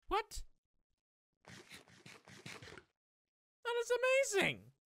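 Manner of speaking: high, loud cry of delight; amazed shout. Real, not acted. Gamer to viewers, finding a lemon, high loud delight. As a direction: burst out in high thrilled amazement. Finds surprise item in game.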